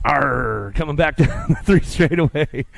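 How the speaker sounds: a quick growled pirate arr with a chuckle; mock pirate. Announcer hams up a pirate for the young racer, playful pirate growl. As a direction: play the little pirate for a laugh. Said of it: "the little pirate".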